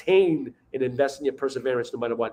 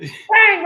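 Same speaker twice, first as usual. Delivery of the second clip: sudden loud high BANG; shouted gunshot bang; 11 dB louder than usual. Retold or imitated. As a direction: yell bang like a shotgun. Mimics shooting a duck.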